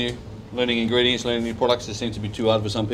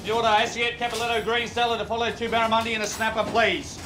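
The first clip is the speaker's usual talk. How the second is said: kitchen order callouts; 9 semitones higher than usual. Real, not acted. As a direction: call the order to the line. Chef calling orders.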